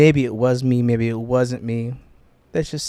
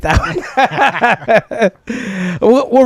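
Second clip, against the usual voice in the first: laughing; 9 semitones higher, 5 dB louder, much slower than usual. Real, not acted. Admits he made the intro.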